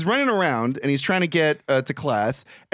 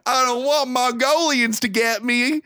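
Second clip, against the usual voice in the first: mock character voice laughing; 8 semitones higher, slower than usual. Joking character line, laughing.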